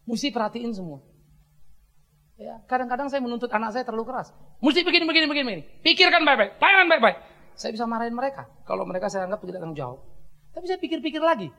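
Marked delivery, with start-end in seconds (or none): 4.6-7.2 higher, louder stern orders; hammed-up demanding dad; mock strict father. Father lectures his children on ministry, mock stern.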